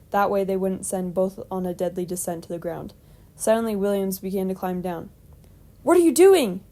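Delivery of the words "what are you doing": high, loud startled question; alarmed question. A narrator's reading. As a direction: call out in sudden alarm. Climber alarmed as companion climbs down.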